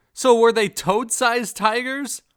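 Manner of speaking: high, loud, slow disbelief; slow, loud, baffled outcry; incredulous. Real, not acted. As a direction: stretch the absurd question out, amazed. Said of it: "I have so many questions".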